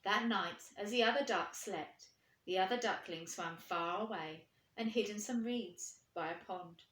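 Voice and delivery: usual speaking voice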